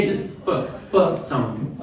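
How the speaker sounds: प said as a soft lip puff, not "पी"; p sound demo. Shown as a demonstration. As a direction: say प alone, voiceless. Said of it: "यहाँ पे पी नहीं है".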